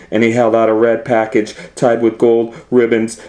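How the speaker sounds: ordinary delivery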